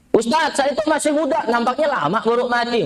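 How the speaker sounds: mock carefree youth brushing off death; lightly voiced cocky young man; young man boasting. Quoted young man boasting to preacher.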